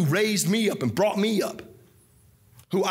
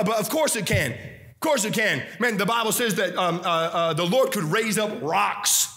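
ordinary talk, nothing marked

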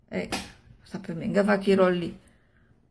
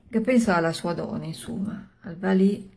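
ordinary delivery, nothing marked

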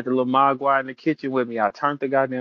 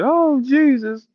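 high, loud hammed-up wailing; exaggerated, drawn-out cry of woe; mock distress; 7 semitones higher, 5 dB louder, much slower than usual. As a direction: moan it out long and high.

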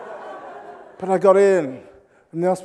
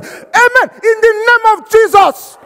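loud, high shouted response; hammed-up fervour at full volume; fervent African congregation; 11 dB louder than usual. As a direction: imitate a church roaring back its amen. Acts African church call and response.

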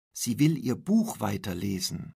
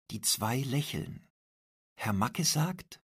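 plain reading voice

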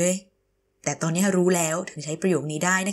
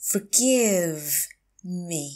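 drawn-out "Forgive", pause, then "me"; slow teacher model of "Forgive me"; slow, broken up; 3 semitones lower, much slower than usual. Shown as a demonstration. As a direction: stretch "Forgive" and separate "me". Teacher repeats phrase slowly.

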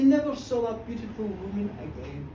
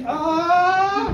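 startled cry; 7 semitones higher, 12 dB louder than usual. Acted. Startled on waking.

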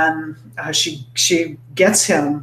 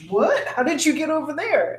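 baffled husband; 10 semitones higher, 4 dB quieter than usual. Acted. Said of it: "he's like".

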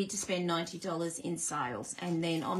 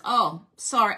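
ordinary talk

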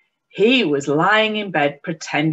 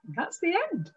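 usual speaking voice

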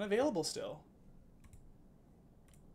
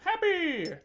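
imitating happy; 13 semitones higher, 6 dB louder than usual. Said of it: "he's like".

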